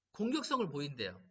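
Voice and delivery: normal talking voice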